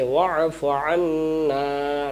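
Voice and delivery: "আন্না" with the final naa stretched; drawn-out ending. Shown as a demonstration. Teacher shows lengthening, "আন্না" ending drawn out. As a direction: hold the last vowel of "আন্না" long. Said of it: "না টান দিতে হবে".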